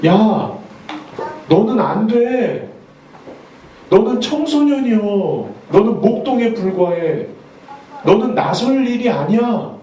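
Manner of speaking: high, slow dismissive king's voice; a king waving off a boy, pitched high and drawn out; disappointed king. Acted. King to young David, pitched high and slowly dismissive.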